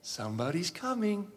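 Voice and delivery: soft, slowing, with long pauses; quiet, weighty wonder; hushed awe. Real, not acted. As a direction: let it sink in, dropping to a reverent hush. Awed at Christ's promised return.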